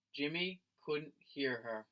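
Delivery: ordinary delivery, nothing marked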